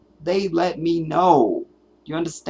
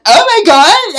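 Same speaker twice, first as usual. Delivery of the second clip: much higher, louder mocking dainty voice; dainty overpronounced son; 13 dB louder than usual. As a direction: ham up a prissy overpronouncing son. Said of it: "his son sounds like this".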